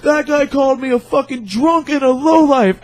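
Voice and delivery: high, loud, dragged-out complaint; imitating a yelling drunk; drunk guy accusing. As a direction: wail the accusation like an outraged drunk. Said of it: "this one really, really drunk guy".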